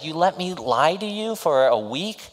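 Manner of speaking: high, disbelieving woman's voice; incredulous woman voiced. Heroine confronting the hero about the week, high and incredulous. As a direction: ask it high, amazed. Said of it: "And she said".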